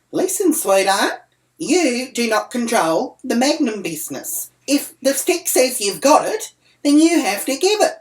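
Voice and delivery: heated re-enacted argument; indignant telling-off. Acted quarrel over free ice cream.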